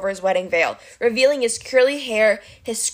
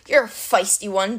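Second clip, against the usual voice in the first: ordinary delivery, nothing marked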